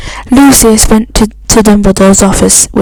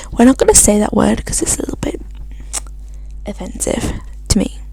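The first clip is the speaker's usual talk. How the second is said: fast whispered aside, much softer; 9 dB quieter, much faster than usual. Real, not acted. Reader explains to listeners in a hushed whisper. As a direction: confide it under her breath.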